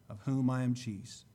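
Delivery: ordinary delivery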